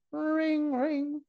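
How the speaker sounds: high sung-out phone ring; ringing phone imitation. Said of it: "That's how phones ring".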